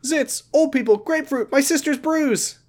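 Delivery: high, put-on character voice; Josh. Josh films his sister's bruise, high mock voice. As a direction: list them off in a silly high voice. Acting Josh's film list.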